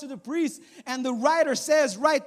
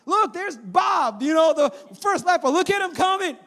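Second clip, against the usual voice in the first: higher, louder amazed pointing; amazed disciples; 6 semitones higher, 4 dB louder than usual. Retold or imitated. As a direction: spot the healed man with wonder. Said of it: "And the disciples like".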